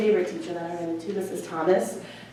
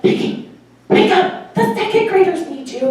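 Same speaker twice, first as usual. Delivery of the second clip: mock urgent dad; 10 semitones higher, 5 dB louder than usual. Dad waking her for subbing.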